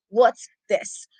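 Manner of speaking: low disgusted grumble. Shown as a demonstration. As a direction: sneer it low. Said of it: "the Duke look".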